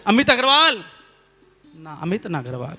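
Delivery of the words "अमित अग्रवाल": high, loud shout of the name; calling out loud. Retold or imitated. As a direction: call into the water hoping for an echo. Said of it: "फिर आवाज़ लगाई". (ऊँची आवाज़ में पुकार)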